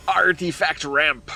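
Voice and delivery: gruff, drawn-out pirate growl. Real, not acted. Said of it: "I don't know why I said that like a pirate".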